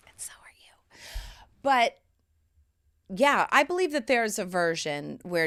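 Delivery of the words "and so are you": breathy hushed aside to the listener; soft whispered encouragement. Real, not acted. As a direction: lean in and whisper it.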